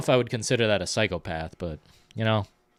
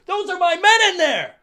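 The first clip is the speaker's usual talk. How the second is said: screamed much higher and louder; furious shout; 9 dB louder than usual. Retold or imitated. Captain Rhodes screaming about his men, much higher and louder. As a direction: lose it over your trapped men. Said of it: "He snaps when he sees".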